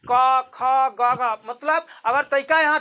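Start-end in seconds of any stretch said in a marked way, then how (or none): 0.1-1.4 slow demo recitation. Shown as a demonstration. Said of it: "देखिए ना आप".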